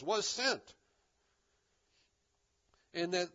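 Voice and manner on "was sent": "was sent" set higher and firmly stressed; higher, emphatic "was sent" with a falling end; emphasis on was sent. Shown as a demonstration. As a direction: land deliberately on both words of "was sent". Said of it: "that's the emphasis".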